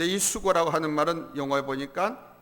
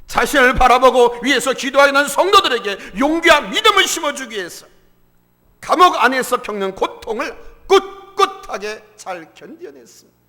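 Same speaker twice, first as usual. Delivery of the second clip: loud, raised, impassioned sermon voice; full-force preaching; 7 semitones higher, 12 dB louder than usual. Acted. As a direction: drive the point home to the congregation. (힘주어 외치는 설교)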